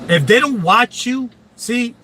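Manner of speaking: ordinary talk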